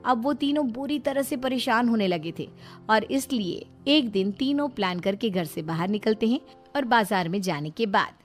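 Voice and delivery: usual speaking voice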